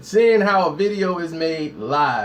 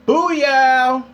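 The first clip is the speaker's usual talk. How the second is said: showman intro; much slower than usual. Pretend. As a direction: stretch the words out like a ring announcer. Hyped intro before making a video.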